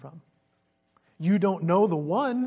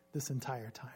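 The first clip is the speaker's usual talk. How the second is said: whisper; 5 semitones lower, 10 dB quieter than usual. Real, not acted. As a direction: drop to a hushed whisper. Preacher's solemn aside.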